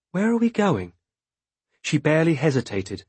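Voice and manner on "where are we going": high, innocent puzzled question; feigned puzzlement. A narrator's reading. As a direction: play dumb and curious. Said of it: "my most puzzled expression".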